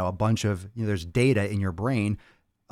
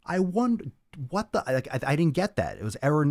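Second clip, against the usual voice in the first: ordinary delivery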